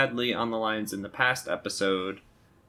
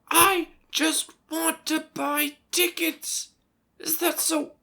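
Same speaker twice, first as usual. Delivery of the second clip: desperate pleading. Acted. Caller pleading with machine.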